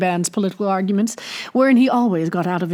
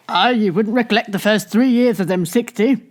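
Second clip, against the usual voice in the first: usual speaking voice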